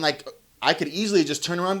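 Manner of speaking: normal talking voice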